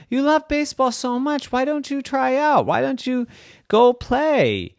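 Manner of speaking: put-on high-pitched mom urging her child; encouraging mommy. A narrator's reading. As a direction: coax brightly in a high voice. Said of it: "her mommy said".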